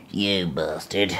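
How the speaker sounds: drawn-out mock insult; slow mock curse. Real, not acted. Man cursing at his dying iPad in a slow mock insult. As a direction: curse it slowly, half joking.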